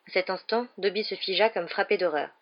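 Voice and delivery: plain reading voice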